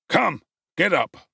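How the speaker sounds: put-on officer seizing the boy roughly; rough policeman. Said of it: "said the man roughly".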